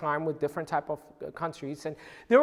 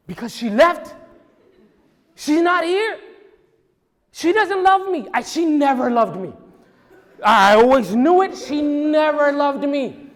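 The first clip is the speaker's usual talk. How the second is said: loud, high-pitched hammed-up wail of a child; mock crying outburst; 7 semitones higher, 10 dB louder than usual. Lost boy wailing to a store employee about his mom, mock crying. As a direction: act out a kid's tearful tantrum, building to a stretched-out yell. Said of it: "As he's crying".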